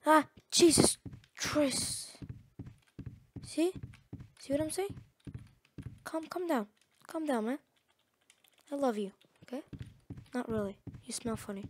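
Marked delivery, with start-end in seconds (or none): 0.1-2.2 shaken. Real, not acted. Recovering from jump scare. 3.4-9.6 scared horror game streamer. Real, not acted. After the jump scare.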